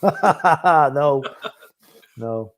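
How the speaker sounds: real laugh breaking into the word; helpless chuckle on a no. Real, not acted. Laughing at a jail joke.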